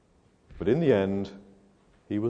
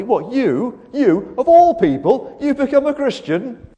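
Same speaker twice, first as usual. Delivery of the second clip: much higher, louder scornful disbelief; mocking friends; 11 semitones higher, 7 dB louder, slower than usual. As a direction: sneer like laughing friends. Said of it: "they're gonna laugh and they say".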